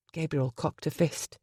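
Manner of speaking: plain reading voice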